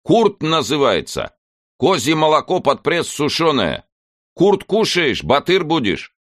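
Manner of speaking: put-on high loud shout with a Kazakh accent; shouting over machinery. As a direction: yell it over the train noise. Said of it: "прокричал старый милиционер".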